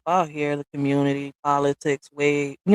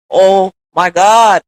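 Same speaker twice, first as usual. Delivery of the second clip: exasperated; 10 dB louder, much slower than usual. Real, not acted. Exasperated at timeline talk.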